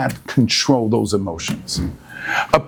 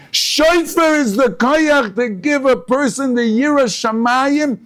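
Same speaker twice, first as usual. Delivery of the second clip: fiery preaching; 12 semitones higher than usual. Acted. Rabbi driving home the shofar's power to his listeners, fervent preaching. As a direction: preach it out with rising fervour.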